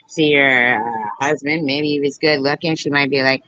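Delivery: ordinary delivery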